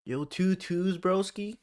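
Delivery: high Toronto street voice; toronto slang accent. Retold or imitated. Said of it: "That's a Toronto accent".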